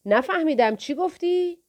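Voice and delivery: put-on a thug taunting the boy as if deaf; mock deafness. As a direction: pretend not to hear, teasing. Thug mocks, feigning deafness.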